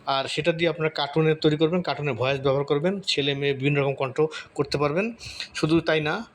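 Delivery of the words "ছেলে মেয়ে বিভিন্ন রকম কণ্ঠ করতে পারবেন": usual speaking voice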